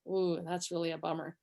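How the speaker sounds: low, soft, sincere sympathy; empathetic. Shown as a demonstration. Demonstrating to husband how to say it, soft empathy. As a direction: sink into a quiet, gentle commiseration. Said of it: "I wanna say it with empathy".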